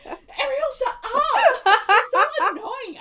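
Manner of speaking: high, loud laughing rant; exasperated yelling. Pretend. Viewer tells annoying Ariel off, exasperated playful yelling. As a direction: shout at her, fed up but amused.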